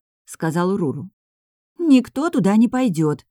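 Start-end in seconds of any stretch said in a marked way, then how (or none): 1.8-3.3 high, louder whiny protest; whining. A narrator's reading. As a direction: whine in refusal, pitch up. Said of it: "заныла Юнсу". (ноющим высоким голосом)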